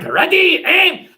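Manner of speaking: high, loud barked order; acted officer's shout; firing squad command. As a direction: call the squad to attention. German firing squad command in joke.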